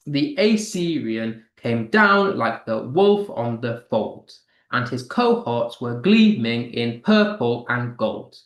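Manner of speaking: "the Assyrian came down like the wolf on the fold" in measured weak-weak-strong beats; anapaestic verse rhythm. Shown as a demonstration. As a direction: make the galloping meter audible, landing on every third syllable, as in "wolf" and "fold". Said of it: "You can kind of hear it come through".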